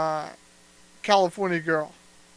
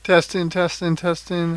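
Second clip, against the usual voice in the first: loud testing call; mic check; 8 dB louder than usual. Real, not acted. Checking the mic, loud clear calls. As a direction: project it into the mic.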